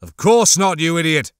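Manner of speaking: loud, contemptuous snap; disgusted retort. Acted. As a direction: bark the insult at him. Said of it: "shook his head in disgust".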